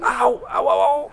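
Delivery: high yelps of pain, ow ow ow; mock cry of pain. Telling friends how the blow hurt, yelping in mock pain. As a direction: replay the hit with little ow cries. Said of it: "a mí sí me dolió".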